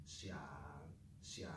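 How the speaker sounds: xia in a low creaky crackle; crackly vocal fry. Retold or imitated. Teacher imitating the linguist's third tone, crackly. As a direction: let xia crackle in the throat. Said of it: "he had that crackle".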